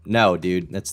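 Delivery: usual speaking voice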